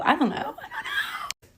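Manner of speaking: giggling it out, voice jumping high; laughing. Real, not acted. Laughing at her own bad choice, giggling. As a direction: laugh through the words.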